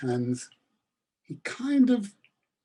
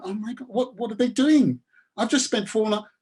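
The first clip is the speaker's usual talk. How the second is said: alarmed farmer; 7 semitones higher, 4 dB louder than usual. A narrator's reading. Farmer alarmed by crashing noises.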